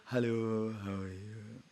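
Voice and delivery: soft, put-on friendly greeting; lightly hammed-up false friendliness; fake politeness. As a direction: greet him sweetly while hiding hatred. Fake politeness after hating him.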